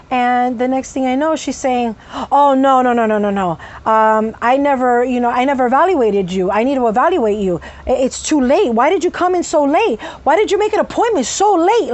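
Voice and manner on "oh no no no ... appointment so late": imitating her rising annoyance; mock scolding that climbs in pitch as it goes; irritated, getting louder. Psychiatrist berating her late patient, increasingly irritated. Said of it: "she was yelling at me and shouting".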